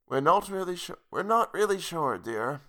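age the voice up, grandma; older grandmother voice. Acted. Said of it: "make this voice older".

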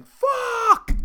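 loud, very high-pitched scream; screaming awake. Retold or imitated. Retelling how he woke from the dream, screaming. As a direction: scream the curse as if jolted out of a nightmare. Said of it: "I literally woke up screaming".

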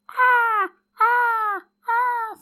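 high squawking gull calls; seagull cries. Pretend. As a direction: caw like seagulls overhead.

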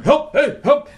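high little calls while pulling up; acted struggle to get up; calling for help. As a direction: call out in short high bursts. Acts pulling himself up by arm.

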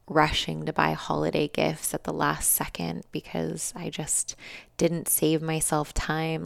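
normal talking voice

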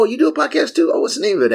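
high-voiced mock interest; retold surprised curiosity. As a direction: mimic their surprised questions up high. Voices people reacting to podcast.

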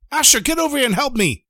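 high, urgent summons; acted commanding shout; shouted order. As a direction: call him over impatiently, pitch way up. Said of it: "he shouts at Asher".

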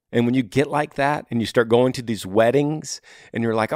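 ordinary delivery

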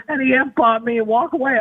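ordinary delivery

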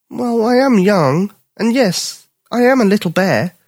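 high, slow cub voice; young bear admitting. Acted. Bipper admitting to the dog he is young, high slow cub voice. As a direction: own up to being little, slowly.

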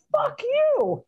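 high exaggerated curse, pitch way up; telling off the PayPal agent, high and mocking; mock furious. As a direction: hurl it at the agent half-laughing.

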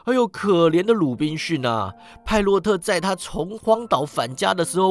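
high, lively exclamations; excited yelling. A narrator's reading. Scrooge points out the parrot, excited yelling. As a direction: rattle on in delighted wonder. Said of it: "史古基大叫".

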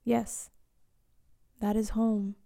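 ordinary delivery

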